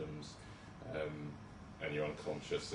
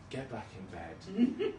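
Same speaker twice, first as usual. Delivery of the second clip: slow firm instruction; 6 semitones higher, much slower than usual. Retold or imitated. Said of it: "that's me saying".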